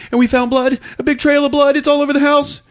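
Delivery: high, loud frantic report; panicked news. Retold or imitated. Ralph telling Gabriel about the blood, panicked high. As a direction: blurt it out in a panic. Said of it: "he's like".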